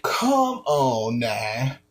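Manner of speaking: ordinary talk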